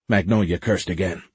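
ordinary delivery, nothing marked

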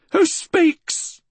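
put-on very high old-woman voice; high, suspicious old woman; sharp challenge. A narrator's reading. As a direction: demand it of an unseen intruder. Blind woman challenges intruder.

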